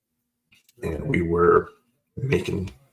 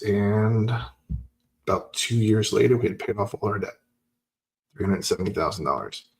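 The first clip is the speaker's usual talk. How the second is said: normal talking voice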